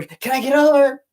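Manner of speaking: high-pitched mock scream of a panicked mom. Mom driving kids, yelling to change lanes, mock screaming. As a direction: shriek it like a frantic mom driving. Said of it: "she'd be screaming over".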